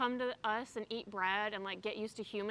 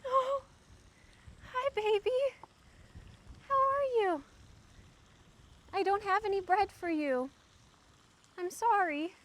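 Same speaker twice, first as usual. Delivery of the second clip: high, loud, cooing pet voice; 9 semitones higher, 5 dB louder than usual. Real, not acted. Talking to a turtle.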